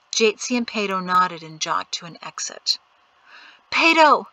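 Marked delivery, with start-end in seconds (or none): 3.7-4.3 loud, high call of a name. A narrator's reading. Sister calling after her brother, loud. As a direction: call him back across the crowd.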